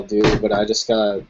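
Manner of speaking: normal talking voice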